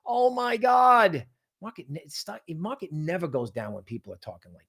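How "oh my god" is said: slow, high, hammed-up panicky whine; mock panicked investor. Mocking crowd fear to viewers, slow hammed-up wail. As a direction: drag it out like a fragile scared trader.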